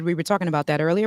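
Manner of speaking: ordinary delivery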